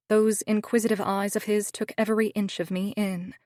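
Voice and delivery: ordinary delivery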